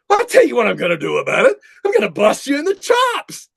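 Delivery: high, loud cartoon tough-guy threat. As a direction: ham it up like a cartoon bully. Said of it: "like a cartoon saying".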